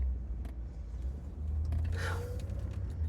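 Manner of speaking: long, soft exhale; weary sigh. Real, not acted. Woman after telling how firefighters just watched, weary sigh. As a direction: let out a long, heavy breath.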